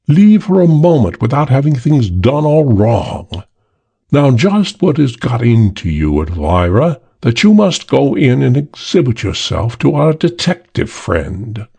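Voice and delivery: a hint of a fed-up British drawl; lightly voiced British gentleman, irritated; exasperated British drawl. The Chinese man rebuking Elvira, mild British drawl. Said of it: "edge of exasperation to the drawl".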